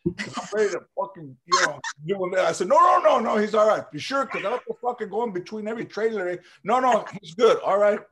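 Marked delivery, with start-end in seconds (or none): 2.7-8.0 loud, high no no no; mock urgent shout; urgent reassurance. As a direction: stop the guard fast.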